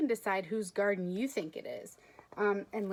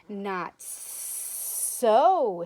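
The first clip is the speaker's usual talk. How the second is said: hissing snake; 8 dB louder than usual. Acted. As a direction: hiss it like a snake under a pine. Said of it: "hissed the snake".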